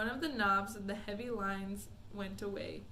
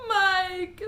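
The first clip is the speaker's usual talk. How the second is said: higher, loud scream of the name; 9 semitones higher, 12 dB louder than usual. Acted. Mother screams for vanished Mike. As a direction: cry out for her lost son. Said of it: "screamed Missus T V".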